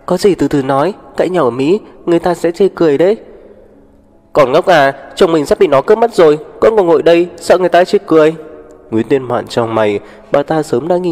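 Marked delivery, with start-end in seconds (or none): 0.1-3.2 higher-pitched, urgent appeal for calm; anxious pleading. A narrator's reading. Daughter stepping between her mother and rival, anxious pleading. 4.3-8.3 loud, high-pitched angry rebuke; furious scolding. A narrator's reading. Mother berating her daughter about a stolen husband, furious scolding.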